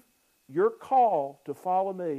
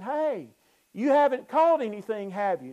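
pitched higher and louder, a pointed teasing call; knowing challenge; 5 semitones higher, 5 dB louder than usual. Shown as a demonstration. Jesus calling to the empty-handed fishermen, knowing and pointed. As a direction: call it out like you already know the answer. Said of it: "the implications in the Greek text is Jesus saying".

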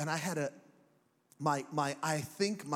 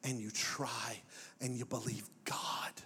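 whispering; 4 semitones lower, 5 dB quieter, slower than usual. Real, not acted. Soft close.

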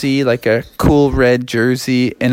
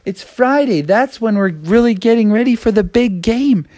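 put-on high-pitched kid pleading to mom; fretting, whiny-kid urgency, pitched up; worried kid; 8 semitones higher than usual. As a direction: voice a child in a mild panic. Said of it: "he was like".

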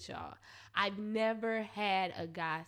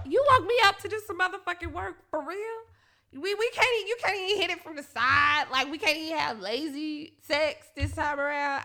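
exasperated complaint; 5 semitones higher, 6 dB louder than usual. Retold or imitated. Complaining to partner in retold scene.

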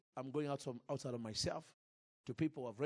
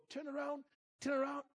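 high mock voice; 11 semitones higher than usual. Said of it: "she would go".

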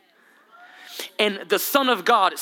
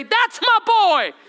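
proud shout; 13 semitones higher, 5 dB louder, slower than usual. Retold or imitated. Parent cheering his son crossing the graduation stage, shouting. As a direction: yell it high and slow from the stands. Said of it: "I'm gonna say".

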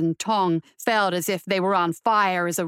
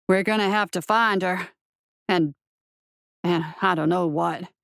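low, hesitant marshal voice; worried marshal; 5 semitones lower than usual. A narrator's reading. Marshal unsure how to find her, worried. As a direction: think aloud low, pausing as you go.

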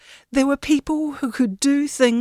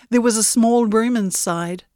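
plain reading voice

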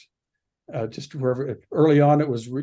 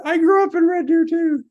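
excited reveal; 8 dB louder than usual. Retold or imitated. Excited reveal of shared hometown.

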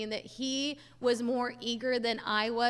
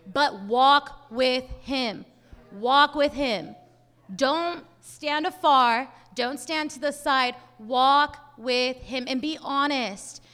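louder, slow, emphatic appeal; preaching exhortation; 6 dB louder, much slower than usual. Acted. Preacher urging listeners to walk with God, emphatic. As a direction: plead with the congregation to walk close.

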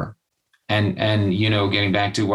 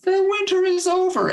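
mock jubilation. Mimicking Los Angeles locals fooled by fake spring, mock jubilation. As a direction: proclaim it in a high, gleeful voice.

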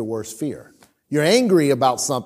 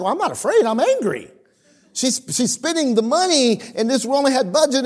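higher, hammed-up indignant denial; indignant protest; 8 semitones higher than usual. Pastor retells arguing with the Lord about his wife's spending, mock indignant and higher. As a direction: play the stubborn young husband arguing back. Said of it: "I was bent out of shape".

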